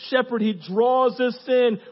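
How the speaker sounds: loud, high, punched phrases; full-force preaching. Real, not acted. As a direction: drive each short line home to the crowd.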